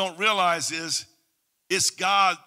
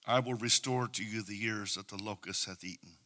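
low, hushed prophetic reading, fading to a whisper; soft scripture recitation; 11 semitones lower, 12 dB quieter than usual. Acted. Preacher whispering God's promises over the people, hushed. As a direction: murmur God's promises softly.

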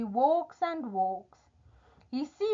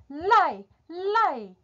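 commanding giant; 7 semitones higher, 4 dB louder than usual. Acted.

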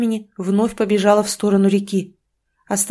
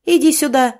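loud summons; 6 semitones higher, 5 dB louder than usual. Acted. Mother summoning daughter.

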